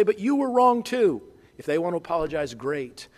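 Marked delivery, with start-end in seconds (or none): 0.0-1.2 high, loud, indignant comeback; mock the grudging apology, much higher and louder; a hammed-up defensive jab; defensive retort. Shown as a demonstration. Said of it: "Don't say".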